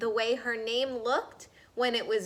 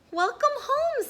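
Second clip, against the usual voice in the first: much higher, bright greeting; higher, louder sing-song welcome; cheerful welcome; 5 dB louder than usual. Acted. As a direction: welcome her home gladly.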